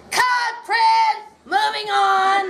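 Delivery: high mock yell of a director. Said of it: "David yelled out".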